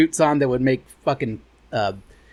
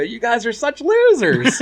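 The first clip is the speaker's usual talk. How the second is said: smug taunt; 6 semitones higher, slower than usual. Retold or imitated. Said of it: "smile, and go".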